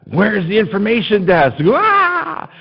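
put-on high panicked child, ending in a wail; mock childish shriek of panic; retold screaming and crying. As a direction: imitate a lost kid bawling. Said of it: "And I'm screaming and crying".

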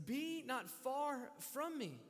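very slow, drawn-out plea. Acted. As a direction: stretch every word as a prayer. Psalmist pleading to God.

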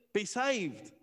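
ordinary delivery

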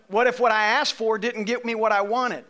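ordinary talk, nothing marked

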